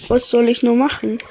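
ordinary talk, nothing marked

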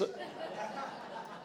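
soft airy laugh; breathy unvoiced laugh. Real, not acted. Laughs at own joke.

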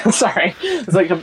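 ordinary talk

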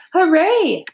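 high, loud cheer; crowd yell. A narrator's reading. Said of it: "yelled the crowd".

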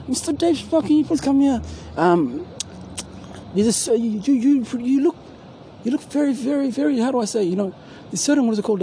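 high-pitched, polite mock official; squeaky-high impression of someone summoning him; put-on caller's voice. Acts out someone calling him over.

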